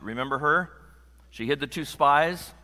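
usual speaking voice

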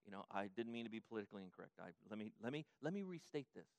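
hushed sarcastic backpedal; mock apology. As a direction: pretend to grovel, dripping sarcasm. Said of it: "And Jesus goes".

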